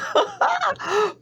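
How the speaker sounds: a burst of high laughter. Real, not acted. Cook laughing at her pure vanilla joke, high cackle. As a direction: laugh at your own joke.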